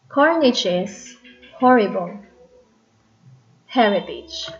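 "horrible" stressed on first syllable, falling away; dactyl stress. Shown as a demonstration. Teacher giving dactyl example, first syllable of "Heritage" stressed. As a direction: separate falling beats on "Carnage is", "horrible", "Heritage". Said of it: "Stress, unstressed, unstressed".

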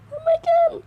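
very high, strained falsetto wail; a comic squeal of despair; squealed why. Real, not acted. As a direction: cry it to the heavens. Despair at failing.

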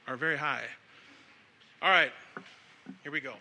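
usual speaking voice

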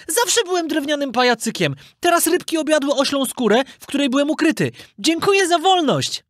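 high-pitched puppet voice, grateful; polite puppet. Acted. Puppet thanking the merchant for freedom, grateful. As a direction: explain and give thanks in a piping voice.

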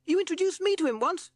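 very high, loud bat voice; squeaky bat. A narrator's reading. Bat reminding Rat of a meeting, squeaky.

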